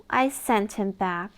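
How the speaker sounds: plain reading voice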